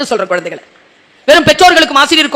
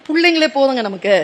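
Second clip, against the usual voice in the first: ordinary talk, nothing marked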